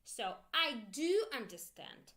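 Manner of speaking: usual speaking voice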